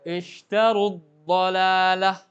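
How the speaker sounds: very slow, loud "ishtaru dhalalah", waw dropped, u running straight into d; waw merged away. Shown as a demonstration. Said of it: "Langsung wawunya diidghamkan".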